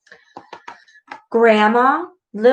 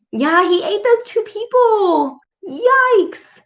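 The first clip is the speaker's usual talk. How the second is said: high excited yikes; 11 semitones higher than usual. Real, not acted. Reader reacting excitedly.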